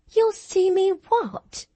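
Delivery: put-on high, shocked girlish question; surprised. A narrator's reading. Cathy cannot believe the lad's curse, high surprise. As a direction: ask in disbelief. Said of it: "asked Catherine in surprise".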